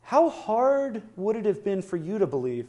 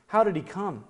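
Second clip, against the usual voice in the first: ordinary talk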